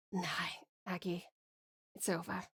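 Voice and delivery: very soft, low, defeated; hushed, resigned Scottish-accented refusal; quiet resignation. Acted. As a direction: give up gently, with little strength left. Wounded lover gives up.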